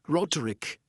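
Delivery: "Roderick" in distinct English syllables, ROD-er-ick; English pronunciation. Shown as a demonstration. Speaker showing the anglicised name, "Roderick" as ROD-er-ick. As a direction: say "Roderick" with a hard d and final k. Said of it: "substituted into English, English ph-, uh, phonetics".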